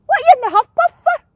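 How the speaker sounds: high, loud, rapid seagull screech. A narrator's reading. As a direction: screech it out like a cheeky gull. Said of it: "screeched the seagull".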